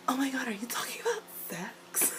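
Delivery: imitated hushed whisper; excited whisper. Sister prying into her brother's love life, mock-scandalised whisper. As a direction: whisper the nosy question under your breath.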